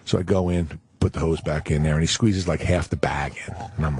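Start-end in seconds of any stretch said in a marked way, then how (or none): none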